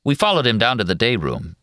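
plain reading voice

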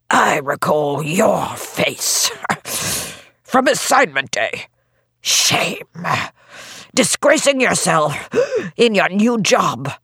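slow, disapproving official; stern mayor. A narrator's reading. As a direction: scold her coldly and slowly. Mayor's voice in the story.

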